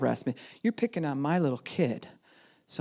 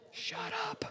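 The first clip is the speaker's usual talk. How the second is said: mock hushing; 12 dB quieter than usual. Speaks to caged inner child.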